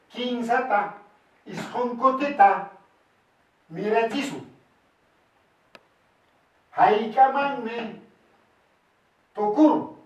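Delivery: word-by-word teacher's dictation with pauses; measured dictation. Shown as a demonstration. Teacher transferring the sum into Quechua, measured dictation. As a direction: set out each term separately and firmly.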